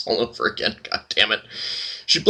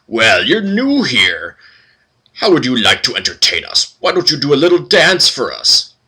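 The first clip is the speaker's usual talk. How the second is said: mocking minotaurs; 4 semitones higher, 5 dB louder than usual. Voices gaudy minotaurs taunting.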